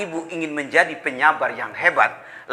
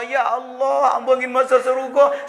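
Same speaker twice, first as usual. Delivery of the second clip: higher, crying out to God; tearful plea; 6 semitones higher than usual. Acted. The weeping wife begging God for heaven, high wail. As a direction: wail the prayer upward.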